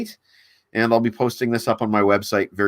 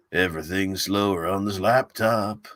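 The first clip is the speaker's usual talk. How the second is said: slowed-down joke read; 4 dB quieter than usual. Shown as a demonstration. Streamer mocking his laggy laptop, slowed drawn-out delivery. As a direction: drag every word out slowly, in mock slow motion.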